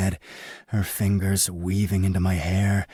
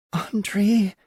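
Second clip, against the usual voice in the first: whimpering; 14 semitones higher than usual. A narrator's reading. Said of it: "she whimpers".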